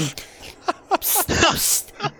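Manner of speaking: high unvoiced psst psst; stage whisper psst. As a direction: hiss a secretive psst. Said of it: "you guys hear a".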